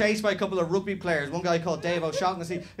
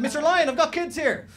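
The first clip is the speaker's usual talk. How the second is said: high mock parent calling the lion; 11 semitones higher, 5 dB louder than usual. Parent at the zoo calling the lion, mock shout. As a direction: call out to the lion, pitched up.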